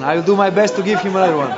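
chuckling while promising another one; laughing. Real, not acted. Joking promise to his opponent.

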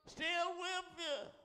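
high, long-held cry; ringing promise. Retold or imitated. Preacher voicing God's promise to believers, high and drawn out. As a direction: lift it way up and stretch every word.

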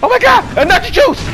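high, loud, exasperated outburst; panicked yelling. Real, not acted. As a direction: shriek in disbelief at the wrong move. Panic over wrong item.

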